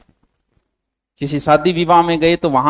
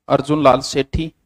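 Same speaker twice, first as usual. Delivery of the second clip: measured note dictation with long pauses. Real, not acted. Teacher reading out notes for students to copy, dictation. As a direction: dictate phrase by phrase for students to write.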